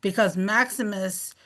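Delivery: normal talking voice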